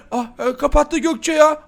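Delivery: normal talking voice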